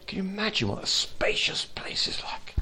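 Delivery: whispered question; hushed wonder. Real, not acted. Preacher inviting the church to imagine, whispering. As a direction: draw them in with a whisper. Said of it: "whispered wonder".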